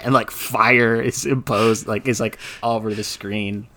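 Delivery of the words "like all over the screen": normal talking voice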